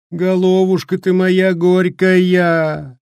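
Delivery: slow, high, wailing sob; sobbing lament. Acted. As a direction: lament it through sobs. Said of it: "навзрыд голосила бабка".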